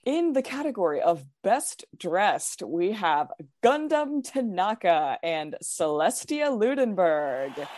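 measured, dramatic awards announcer voice. Pretend. Reading out the final superlative results to friends, dramatic announcer voice. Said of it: "dramatic announcer voice?".

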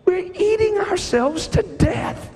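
high, slow, shouted sermon peak; fiery preaching. Acted. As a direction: thunder it from the pulpit. Preaching climax on gluttony.

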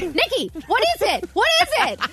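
high, frantic questioning that climbs on the repeat; retold panic. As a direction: re-enact demanding an answer in a scare. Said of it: "you weren't saying anything. I'm like".

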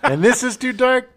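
high, loud incredulous squeal; mock disbelief. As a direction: act shocked it's too dark. Mocking Pacino's objection.

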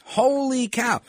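high, drawn-out sportscaster exclamation; imitated broadcaster's amazed cry; Phil Rizzuto. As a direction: exclaim it high like an old ballgame call. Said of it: "this is like Phil Rizzuto".

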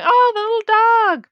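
high, loud, drawn-out mock concern; exaggerated imitation of a dog lover; mock fussing. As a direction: coo loudly over a lost dog, hammed up. Mimics film character fussing over dog.

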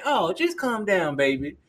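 slow, coddling reassurance, imitated; soothing lady cop. Lady cop to panicking young man, imitated soothing. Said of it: "she's gonna cater to you".

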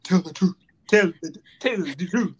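lightly imitated bad film accent; mock the movie line with a put-on accent; Will Smith's Concussion accent. Mocking a terrible accent in Concussion, lightly put-on imitation. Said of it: "Almost as bad as Will Smith in".

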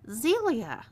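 snapped. A narrator's reading. Woman rebukes her sister for the punch, snapping. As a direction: snap the name as a reprimand. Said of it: "snapped her sister".

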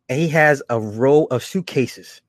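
ordinary talk, nothing marked

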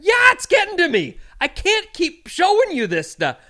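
high, loud retold exasperation; hot-headed retort. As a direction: re-enact losing patience with a clerk. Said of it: "when someone's hot-headed".

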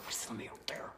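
voiceless, curious whisper; cat's whisper. Retold or imitated. Cat peers through the front door, whispering.

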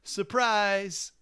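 put-on high, loud, slow eager kid; chirpy boy offering to help. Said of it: "he's like".

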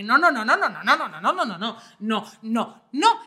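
rattled-off run of panicked no's; re-enacted collapse. Daughter retelling her breakdown in the kitchen, mock-panicked denial. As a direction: repeat the no's like a mind shorting out. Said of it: "porque yo entré en colapso".

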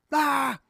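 short, high "Ah!" mimicking an aftershave-sting scream; imitated movie scream. Acting out the boy's aftershave moment from the movie, high mock scream.

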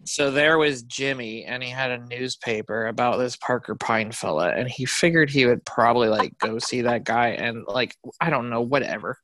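low, listless mock narration; half-hearted storytelling in a dropped pitch; fed-up narrator. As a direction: imitate a reader who has stopped caring. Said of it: "that's how the narration felt".

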